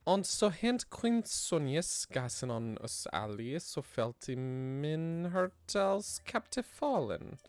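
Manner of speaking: put-on slow, solemn old-text reading; archaic reading. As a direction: read it like an ancient chronicle. Reads old archaic tablet text.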